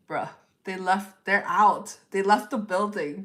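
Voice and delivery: loud, blunt declaration; firm emphatic telling-off. Real, not acted. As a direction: lay it down hard: they're gone.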